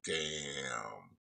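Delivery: low, very soft drawn-out murmur; very soft, low reaction to bad news; hushed dismay. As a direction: let it sink out quietly, stunned. Reacting to a sad scene.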